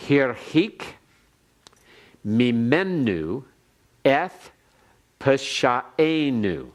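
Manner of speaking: slow Hebrew, word by word; careful Hebrew recitation; Hebrew read aloud. Shown as a demonstration. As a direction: read it out for the class to follow. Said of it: "Let me read it one more time".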